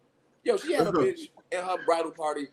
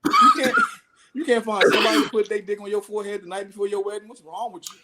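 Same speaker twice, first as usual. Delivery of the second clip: higher, fast, loud, laughing disbelief; incredulous yell; 7 semitones higher, 6 dB louder than usual. Retold or imitated. Scolding the bride over no stripper, laughing outrage. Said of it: "I was like".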